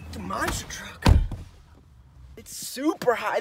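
usual speaking voice

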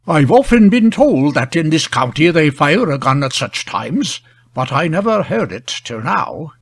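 plain reading voice

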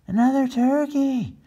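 high urgent call; boy's voice. Acted. Chris alerting his father, urgent. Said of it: "said Chris".

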